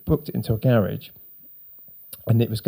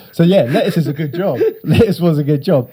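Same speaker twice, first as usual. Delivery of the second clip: laughing; 5 semitones higher, 5 dB louder than usual. Real, not acted. Laughing at the sandwich job.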